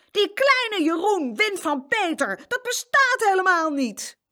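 high, loud disbelief; astonished boy's outcry; incredulous. A narrator's reading. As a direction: protest that it simply can't be. Boy stunned by the winner.

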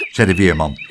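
ordinary delivery, nothing marked

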